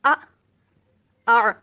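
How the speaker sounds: first آ a glottal jolt, second آ squeezed from the throat; hamza and ain. Shown as a demonstration. Teacher practising hamza and ain for students, loud. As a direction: contrast the two throat sounds. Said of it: "practice کرتے ہیں".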